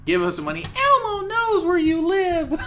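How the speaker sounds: squeaky threatening Elmo. Pretend. Continues the Elmo bit.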